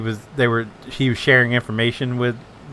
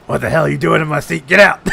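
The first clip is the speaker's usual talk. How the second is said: fast, louder angry captain; mock angry yell; 3 semitones higher, 5 dB louder, faster than usual. Friend imitating captain kicking someone out, mock yelling. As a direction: bark him out of the seat. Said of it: "He comes up".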